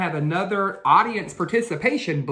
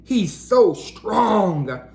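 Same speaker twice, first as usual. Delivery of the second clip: slow, weighty tough-cat voice; slow strong cat; 4 semitones higher, 4 dB louder, much slower than usual. Acted. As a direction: drag it out like a big tough guy. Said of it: "said Spike".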